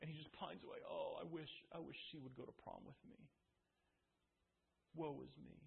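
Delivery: soft, droopy mock lament of a jilted boy; mock sulky moping. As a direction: sigh it out like a sulking teenager. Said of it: "just sits and sulks and says".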